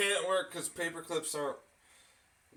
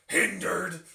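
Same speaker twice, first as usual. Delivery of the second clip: "Hindered" fast and jabbed, louder; biting, jabby; 2 semitones higher, 7 dB louder than usual. Shown as a demonstration. Streamer showing the bite of the new insult, a fast loud jab. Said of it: "it has a little bit of a bite to it".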